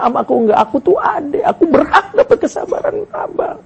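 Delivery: high, plaintive pleading that climbs into a wail; imitating frightened, tearful sister. Frightened younger sister pleads with her brother for patience, tearful high wail. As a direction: act out a hurt little sister's tearful protest, pitch rising steeply. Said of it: "dalam keadaan ketakutan mengatakan".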